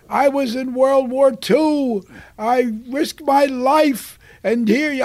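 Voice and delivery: loud, slow righteous protest; indignant veteran. Retold or imitated. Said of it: "he said".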